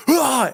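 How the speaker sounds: a sudden high "Ah" to startle; mock scare yell. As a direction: jump out with a quick playful "Ah". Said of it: "be in there and be like".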